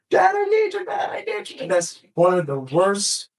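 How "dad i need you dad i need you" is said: much higher, strained imitation of a sobbing daughter; retold desperate crying plea. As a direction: voice the hurt child begging for her dad. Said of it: "she's crying hysterically".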